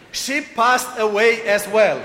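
a visitor picking each English word with care; mock careful non-native English, slowed down; careful best English. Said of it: "And he said".

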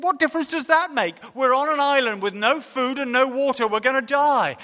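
exasperated terror at his calm; frantic disbelief. Acted. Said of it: "he panicked".

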